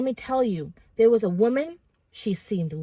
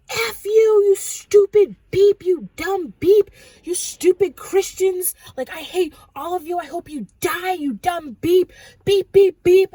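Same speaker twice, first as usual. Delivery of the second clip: loud, high yell as a raging demon; demonic screaming rant; 8 semitones higher, 9 dB louder than usual. Retold or imitated. Demon speaking through a woman at the dreamer, screamed. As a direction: hurl the insults loudly in a raised pitch. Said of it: "like these demons speaking through her".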